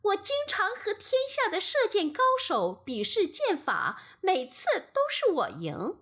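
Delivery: high, quick, unconvinced bragging; proud son refusing to back down; defiant protest. A narrator's reading. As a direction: insist indignantly that you always win. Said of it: "阿波罗不服气地说".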